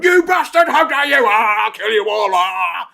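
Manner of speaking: hammed-up furious screaming, very high and loud; mock road-rage outburst building straight out of calm chat. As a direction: act out unhinged screaming rage at a pedestrian. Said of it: "really angry in the car".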